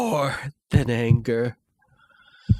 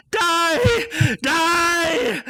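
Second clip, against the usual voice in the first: high, loud frenzied scream; crying out; 4 dB louder than usual. Acted. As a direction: scream it as you rush at him. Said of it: "I rushed into the room crying".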